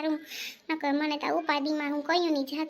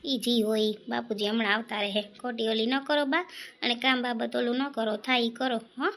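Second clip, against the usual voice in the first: soothing son; 4 semitones lower than usual. Acted. Son soothes his mother.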